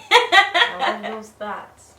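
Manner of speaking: slow, laughing through it. Real, not acted. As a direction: laugh the words out slowly.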